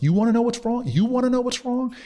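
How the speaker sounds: high-pitched, worked-up mock anger; retold anger. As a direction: act out losing your temper, pitch shooting up. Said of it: "I just got, like, angry".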